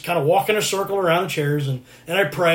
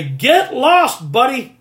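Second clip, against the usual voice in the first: punchy, higher, louder rebuke; raised voice; 6 semitones higher, 5 dB louder, much slower than usual. Shown as a demonstration. Preacher telling the devil off, raised voice. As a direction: send the devil packing, loud and high. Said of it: "raise your voice at the devil".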